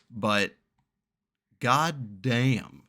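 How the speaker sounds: ordinary talk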